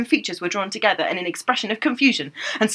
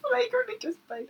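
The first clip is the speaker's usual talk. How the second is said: laughing; 13 semitones higher, 5 dB louder than usual. Real, not acted.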